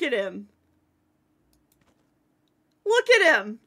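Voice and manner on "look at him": higher, louder delighted exclaim; excited. Real, not acted. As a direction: point him out with glee. Repeated with more excitement.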